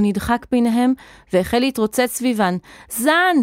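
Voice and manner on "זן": high calling-out cry; urgent high shout for someone lost. A narrator's reading. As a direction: call the name in panic. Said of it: "צעק".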